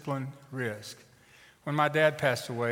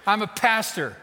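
raised, louder announcement; emphatic declaration; 6 semitones higher, 5 dB louder than usual. Acted. Preacher stands up to Papa Bear, emphatic. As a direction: state it boldly, pitched up.

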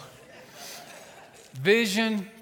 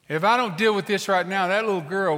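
raised, louder insistence; forceful rebuke; 5 semitones higher, 6 dB louder than usual. Acted. Preacher lectures Papa Bear about little girl, forceful. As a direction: press the point hard. Standing up to the angry father.

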